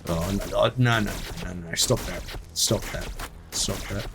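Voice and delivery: soft, muttered no's and stops; quiet pleading. Real, not acted. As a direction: beg quietly under your breath. Pleading as units die.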